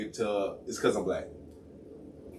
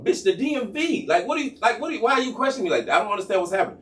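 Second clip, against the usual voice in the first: high, exasperated comeback; retold incredulous protest; annoyed; 8 semitones higher than usual. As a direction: push back with pitched-up indignation. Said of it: "So I'm clearly getting annoyed".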